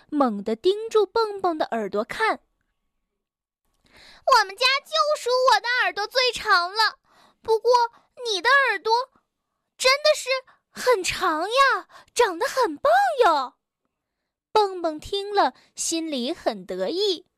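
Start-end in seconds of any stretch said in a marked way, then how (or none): 4.4-13.5 very high, sweet-talking busybody; flattering squeaky rabbit. Acted. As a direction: lay the compliment on thick. Suqi flattering.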